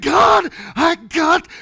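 very high, loud acted-out cry; anguished shout. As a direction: yell a hammed-up desperate prayer. Re-enacting prayer while convulsing.

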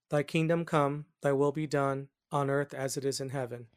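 normal talking voice

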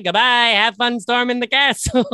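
imitating Miracle Max. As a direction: wave them off like Miracle Max. Quoting Miracle Max farewell.

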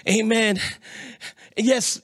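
ordinary talk, nothing marked

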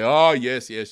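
crowd cheer. Retold or imitated. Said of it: "And they say".

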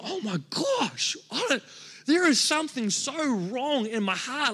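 mock-cry the moment of brokenness; tearful realization. Pastor retelling his breakdown to the church, voice rising near tears. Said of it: "I started to cry".

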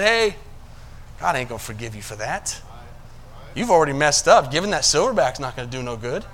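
low, quiet devil whispering in his ear; lightly voiced sneering devil; devil's voice. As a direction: taunt him quietly like the devil. Devil voiced tempting Judas.

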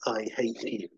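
ordinary talk, nothing marked

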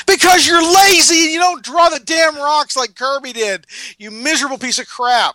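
high-pitched exasperated rant; angry rant. Real, not acted. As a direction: rail at the lazy artist.

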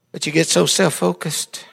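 ordinary delivery

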